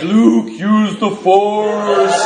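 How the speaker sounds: very slow, high, drawn-out movie line; laughing through a slow, hammed-up Star Wars quote; drunk Star Wars voice. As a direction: ham up Obi-Wan like a loud drunk. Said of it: "Loud in Star Wars".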